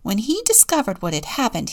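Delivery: ordinary delivery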